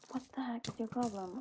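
whispered irritation; whispering. Real, not acted. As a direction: hiss it quietly. Whispered annoyance.

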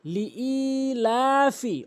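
লিইলাফি with a long separate ee; slow "li-eee-laa-fi"; correct recitation. Shown as a demonstration. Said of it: "বলতে হবে".

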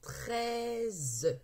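"Treize" stretched, long "trei" then separate "ze"; word sounded out. Shown as a demonstration. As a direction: draw out "Treize" and voice the final "ze". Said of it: "Maintenant, nous allons lire les mots". ("Treize" déchiffré lentement, le "ze" final détaché)

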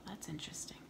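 soft whispered aside. Real, not acted. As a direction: whisper it to yourself. Aside during the reading.

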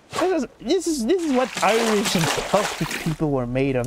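put-on Irish lilt; Irish accent. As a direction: declare it like a proud Irishman. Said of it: "That's why I'm speaking like an Irishman".

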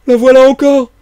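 very high, loud startled shout; alarmed outcry. Acted. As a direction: cry out as the ghostly voice returns. Marvel hears the voice again.

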